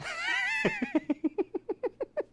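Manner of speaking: a sharp, very high laugh at the crew; high mocking laugh. Crew boss mocking the crew, very high laugh. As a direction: laugh high and mean at your own jab. Laughing at his own put-down.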